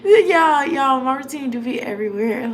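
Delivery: loud, high amused outcry; excited confession. Real, not acted. Telling her viewers how messy her routine is, loud and amused. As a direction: call out to the viewers, cracking up.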